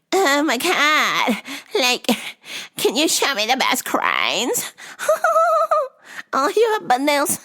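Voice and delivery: hammed-up high Valley Girl customer; Miss Swan Valley Girl voice. Odd customer at the store, high mock Valley Girl. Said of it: "talking like a Miss Swan ... Valley Girl accent".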